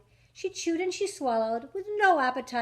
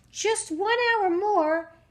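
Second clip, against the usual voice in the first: sleepy voice; 7 semitones higher, 5 dB louder, slower than usual. A narrator's reading. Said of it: "His voice turned into a snore".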